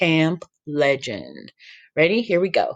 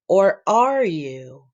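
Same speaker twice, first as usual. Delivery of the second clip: put-on goofy boy voice; 3 semitones higher, much slower than usual. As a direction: drag it out like a strange kid. Sammy's odd retort.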